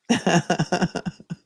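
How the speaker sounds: real, unforced laugh; amused chuckle. Real, not acted. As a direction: chuckle to yourself at a comment. Amused by a chat comment.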